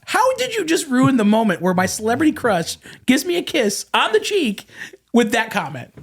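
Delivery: high-pitched mock outrage; incredulous reproach. Telling friend off for ruining the kiss, high incredulous. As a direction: replay the scolding, aghast.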